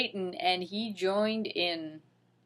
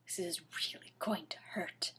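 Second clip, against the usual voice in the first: whisper; 4 semitones lower, 16 dB quieter, faster than usual. Real, not acted. Narrator whispering to listeners before reading on. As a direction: drop to a hushed whisper for the warning.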